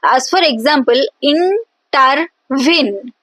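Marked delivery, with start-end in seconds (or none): 1.2-3.1 "intervene" slowly broken up as in, ter, VEEN; syllables separated. Shown as a demonstration. Teacher giving an example of anapest, slowly syllable by syllable. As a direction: pause between each syllable of "intervene", landing on the stressed last. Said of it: "two unstressed syllable followed by a stressed syllable".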